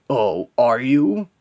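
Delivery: slow, loud, rising mock question; mocking villain. Villain answering the hero's threat, mocking. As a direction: draw it out with scornful upswing.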